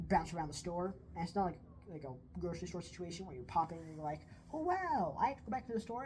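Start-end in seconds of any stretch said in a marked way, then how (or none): 4.5-6.0 high-pitched, louder 'oh, wow' then easing; hammed-up sudden realization; mock surprise. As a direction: play an exaggerated surprised shopper. Acting imagined impulse shopper.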